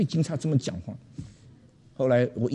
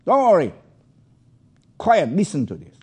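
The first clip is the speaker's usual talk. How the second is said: urgent; 5 semitones higher, 5 dB louder than usual. Retold or imitated. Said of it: "我说".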